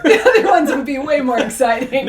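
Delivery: laughing through the joke. Real, not acted. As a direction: giggle while you say it. Joking, laughing at the slip.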